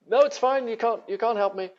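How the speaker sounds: high, bright, breezy reply; a chirpy, innocent no-thanks; cheerful brush-off. Retold or imitated. Painter brushing off the office worker, high and breezy. His own reply, retold.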